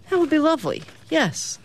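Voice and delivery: high-pitched, falling lilt; polite cartoon cat. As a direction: accept sweetly in a high voice. Cat politely accepts a drink.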